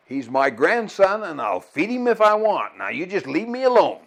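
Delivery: indignant grandma. Retold or imitated. Grandma telling off grandpa about feeding her grandson, indignant. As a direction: imitate grandma firmly standing her ground. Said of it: "Her response was".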